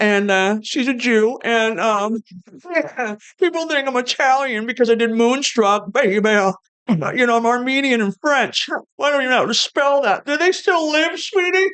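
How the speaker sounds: high, put-on diva voice. Shown as a demonstration. Comic improvising as the star for a laughing host, high diva impression. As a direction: climb in pitch like a sassy pop diva. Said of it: "do a [name] impression".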